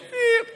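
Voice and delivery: squeaky high beep with a laugh; mock beep. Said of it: "it's like".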